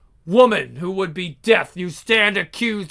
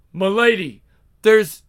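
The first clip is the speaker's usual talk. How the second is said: usual speaking voice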